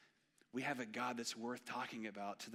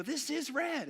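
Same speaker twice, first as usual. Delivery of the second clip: put-on much higher, louder childish insistence; kid insisting; 10 dB louder, slower than usual. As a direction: play the stubborn little boy. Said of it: "I go".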